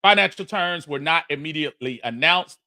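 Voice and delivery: ordinary talk, nothing marked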